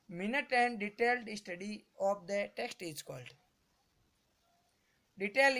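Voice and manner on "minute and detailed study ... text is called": reads the English question out very slowly, word by word; very slow dictation. Shown as a demonstration. Teacher reading a question to students, very slow. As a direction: drag each word out as if for dictation.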